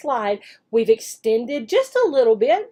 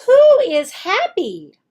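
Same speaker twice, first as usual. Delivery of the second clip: higher, louder, slow question; teacher question; 7 semitones higher, 7 dB louder, slower than usual. Shown as a demonstration. Teacher demonstrates the new question, higher and louder. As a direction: ask it brightly to the class. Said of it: "let's use".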